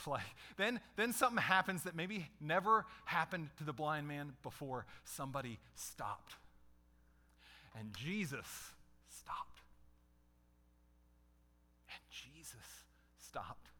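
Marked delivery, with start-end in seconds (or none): 5.0-13.7 slow, low, softer emphasis; slow, quiet dramatic repetition; slow and hushed. Real, not acted. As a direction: let the stop land, slowly. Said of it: "dramatic repeated line".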